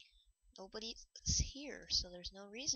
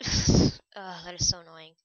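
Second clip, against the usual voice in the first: loud, fed-up groan of annoyance; really annoyed, louder outburst; exasperated by hiccups; 15 dB louder than usual. Real, not acted.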